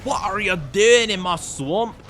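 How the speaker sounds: slow, drawn-out ogre voice; a playful attempt at Shrek; Shrek impression. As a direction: do your best Shrek. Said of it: "I wish I could do a Shrek impression".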